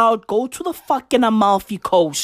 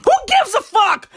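shout; 9 semitones higher than usual. Real, not acted. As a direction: blow up at the whole question. Outburst over name pronunciation.

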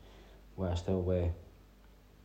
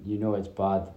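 usual speaking voice

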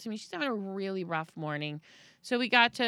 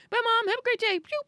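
put-on high, loud, chirpy little boy; cheery kid; 8 dB louder than usual. Son hopping out at school, high cheery goodbye. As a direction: bounce out of the car happy.

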